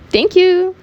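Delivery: high, bright-smiled thank you; smiling thanks. A narrator's reading. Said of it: "The girl smiled".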